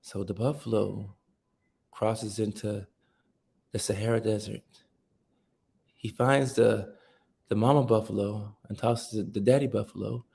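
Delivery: playful take on a late-night documentary voiceover; soothing documentary narrator. Pretend. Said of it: "very soothing voice".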